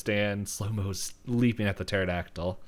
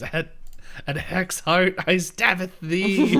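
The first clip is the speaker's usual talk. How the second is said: high, loud theatrical battle cry; hammed-up yell, slowing on the last words; mock scream; 11 semitones higher, 6 dB louder, slower than usual. Stan attacks the pterodactyl, high mock scream. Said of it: "as Stan screams".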